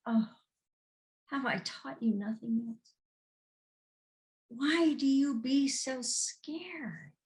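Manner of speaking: slow, lightly voiced master chiding; a hint of a patient teacher; gentle rebuke. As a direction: calmly tease their fear. Jesus gently chides disciples.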